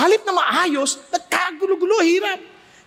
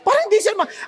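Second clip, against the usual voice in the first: normal talking voice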